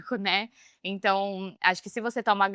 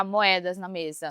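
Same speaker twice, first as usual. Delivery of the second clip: normal talking voice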